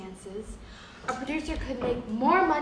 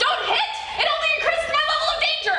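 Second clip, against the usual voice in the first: high, loud, fast panicked cries; hysterical outburst; 7 dB louder, faster than usual. Acted. Accountant warns boss off, shrill hysterical cries. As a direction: gabble it out in terror. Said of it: "I'm hysterical!".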